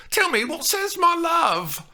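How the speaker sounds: high, loud eager blurt; blurted question. A narrator's reading. Juliet blurts her question to the nurse. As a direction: blurt it out, can't wait. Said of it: "she blurted out".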